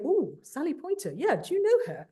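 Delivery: a hint of an excited overseas fan; eager fan. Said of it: "in other places in Europe who say".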